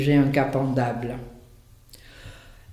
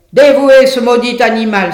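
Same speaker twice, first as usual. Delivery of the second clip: furious wolf; 6 semitones higher, 10 dB louder than usual. Acted. Wolf's harangue against the donkey.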